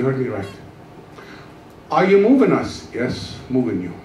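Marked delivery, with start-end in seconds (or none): none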